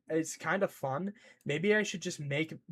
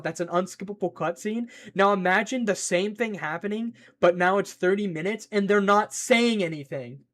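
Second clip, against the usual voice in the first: usual speaking voice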